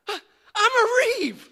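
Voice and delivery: put-on high, elated little boy voice; light up with discovery; a kid brother suddenly thrilled; joyful realization.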